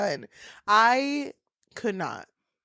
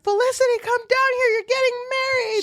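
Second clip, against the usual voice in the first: super excited mom. Retold or imitated. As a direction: call upstairs in delight. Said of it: "She's, like, super excited".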